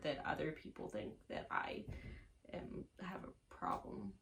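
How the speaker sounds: whispered confession; a secretive whispered aside. Real, not acted. Confessing her crying, dropping voice.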